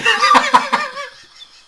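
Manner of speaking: loud helpless laugh; high burst of laughter. Real, not acted. Laughing at dad's CB story, high helpless laugh.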